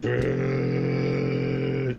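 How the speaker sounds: low zombie groan. Pretend. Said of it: "acting like a zombie".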